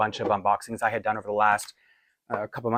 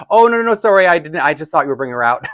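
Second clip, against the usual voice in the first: much higher, louder embarrassed backpedal; flustered apology; 5 semitones higher, 7 dB louder than usual. Retold or imitated. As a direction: hurry to take it back, caught off guard. Flustered apology in retold awkward moment.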